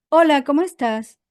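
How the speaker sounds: bright, loud upbeat hello; cheerful greeting. Shown as a demonstration. Coach showing a lively greeting, loud and upbeat. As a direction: greet them with lots of energy. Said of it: "Si yo hago así y digo".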